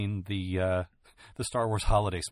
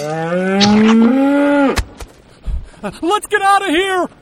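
very high, loud fleeing shout; panicked yell; 16 dB louder, much slower than usual. Acted. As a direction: scream and run. Cow flees in panic.

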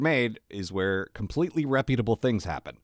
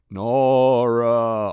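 loud moan; 8 dB louder than usual. Retold or imitated. Moaning frozen head, louder.

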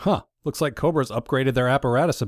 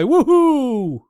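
loud, high sarcastic cheer; excited whoop; 13 semitones higher, 7 dB louder than usual. Acted. Mock cheer for convoy.

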